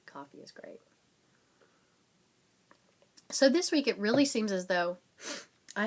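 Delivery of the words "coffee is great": breathy whisper to herself; whispered aside. Real, not acted. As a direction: whisper it like a secret. Aside about coffee, whispered.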